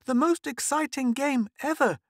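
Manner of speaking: put-on high breathless little girl gasping; gasped, excited girl. Girl bursting in to tell her mother, breathless. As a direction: gasp it out, thrilled and winded. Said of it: "gasped Mink".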